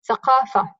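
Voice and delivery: ordinary talk, nothing marked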